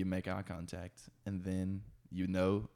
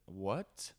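normal talking voice

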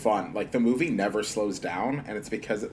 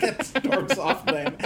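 ordinary talk, nothing marked

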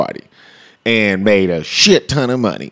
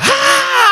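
high laugh. Real, not acted. Laughs at the memory.

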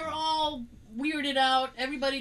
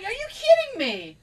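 mock outrage; 10 semitones higher, 5 dB louder than usual. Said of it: "I was like".

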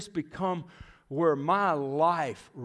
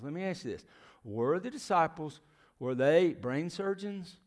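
normal talking voice

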